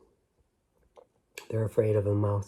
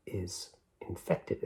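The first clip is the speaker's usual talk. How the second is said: plain reading voice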